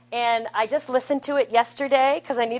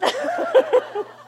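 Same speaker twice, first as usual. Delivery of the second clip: bright high burst of laughter; high laugh at the story; 5 dB louder than usual. Real, not acted. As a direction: laugh out loud at the pigeon bit. Laughs again at pigeon joke.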